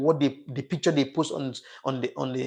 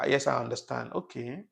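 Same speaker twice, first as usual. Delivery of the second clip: normal talking voice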